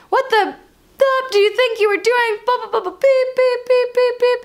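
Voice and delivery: high-pitched mock tirade of bleeps; imitated yelling. Driver leaning out to swear at her, mock yelling. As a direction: rant high, drowning the swearing in beeps. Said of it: "yelling swear words".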